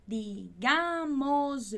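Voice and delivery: "Digámosle" drawn out, weight on GÁ; showing where the stress falls; slow stress demo. Shown as a demonstration. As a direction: slowly lean on the GÁ of "Digámosle". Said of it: "E a ênfase da palavra".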